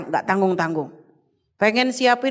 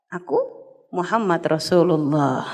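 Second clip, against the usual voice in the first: jawaban Nabi Muhammad; 6 semitones lower than usual. Retold or imitated. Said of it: "Akhirnya dijawab".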